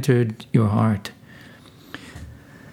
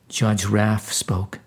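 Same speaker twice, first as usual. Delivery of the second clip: plain reading voice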